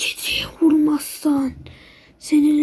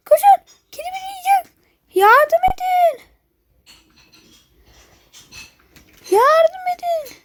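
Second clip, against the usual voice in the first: mock panic; 11 semitones higher than usual. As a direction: wail for help as the cat closes in. Chased by a cat, calls help. (yüksek perdeden, yavaş, sahte panikle yardım çağrısı)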